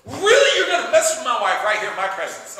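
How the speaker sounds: loud laughing disbelief, pitched high; loud mocking laugh at the nerve of it; incredulous laugh. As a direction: laugh it out, outraged and amused. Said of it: "The king comes in and says".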